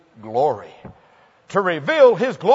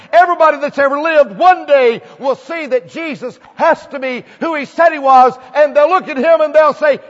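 louder, higher, driving sermon cadence; fiery preaching; 6 semitones higher, 6 dB louder than usual. Acted. Pastor thundering to the congregation about every unbeliever, fiery preaching. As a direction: build the list to a crescendo.

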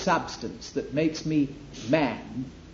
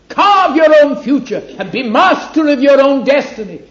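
loud, soaring, much higher, laughing invitation; grand temptation; 6 semitones higher, 9 dB louder than usual. Acted. Preacher voicing the devil luring man, loud with a laugh. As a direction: sell independence like a triumphant showman.

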